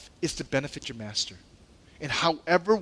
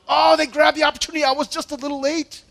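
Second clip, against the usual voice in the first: quick, high, regretful grumble; jealous disciples' thoughts; 10 semitones higher, 5 dB louder than usual. Retold or imitated. Disciple sulking that the brothers beat him to it, fast high grumbling. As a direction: voice a jealous disciple kicking himself for being too late. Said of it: "they're probably thinking to themselves".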